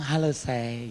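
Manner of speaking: soft, affectionate; tender greeting. Retold or imitated. Husband greets his wife softly. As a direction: coo it to your spouse.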